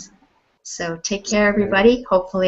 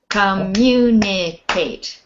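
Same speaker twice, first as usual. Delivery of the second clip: "Communicate" drawn out slowly, weight on MU; stress on second syllable; slower than usual. Shown as a demonstration. Teacher correcting a guess of last syllable, slow "Communicate" stressed on MU. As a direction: spell out the beats of "Communicate", MU strongest. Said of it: "Not the last syllable".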